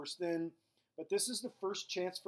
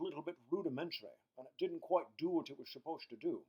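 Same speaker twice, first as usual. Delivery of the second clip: slurred Scottish celebrity impression; Sean Connery. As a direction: lecture in a put-on Connery-style Scottish voice. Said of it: "Sean Connery".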